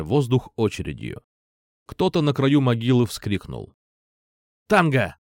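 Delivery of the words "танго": short, tossed-out command; terse signal to a partner; curt codeword. A narrator's reading. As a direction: throw it out curtly, one quick word. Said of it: "коротко бросил я".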